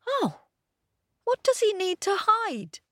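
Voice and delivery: high, eager puppy voice; little dog voice. A narrator's reading. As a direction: squeak it like a small dog. Pip voiced.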